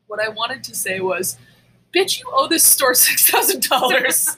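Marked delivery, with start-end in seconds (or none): 1.9-4.4 laughing mock telling-off. What she wanted to tell thief.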